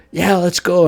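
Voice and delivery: pumped up, ready to go; eager hype. Retold or imitated. Recapping Itadori agreeing with eager hype.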